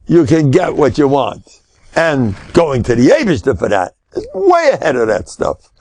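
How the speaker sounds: normal talking voice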